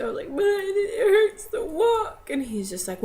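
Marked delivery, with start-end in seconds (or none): 0.3-2.3 high, loud, drawn-out whine; mock crying. Daughter whining to dad on the phone, tearful wail. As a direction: wail it slowly like a hurting kid. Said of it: "I broke out into tears".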